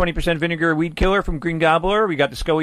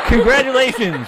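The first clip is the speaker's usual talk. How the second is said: excited; 6 semitones higher, 5 dB louder than usual. Acted. Mock-grand prize announcement.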